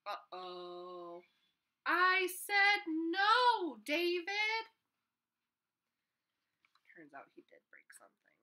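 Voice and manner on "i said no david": high, loud, drawn-out scold; exasperated mom yelling enough. Acted. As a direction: stretch it like a mom at her limit. Mom scolding broken pot.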